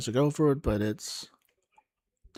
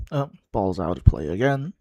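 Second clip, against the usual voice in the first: usual speaking voice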